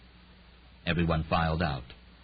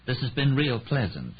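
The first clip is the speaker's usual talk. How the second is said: dry; 6 semitones higher than usual. A narrator's reading. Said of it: "Percy Clay said dryly".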